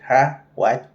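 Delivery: imitated rude saleswoman. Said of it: "the Thai lady was like".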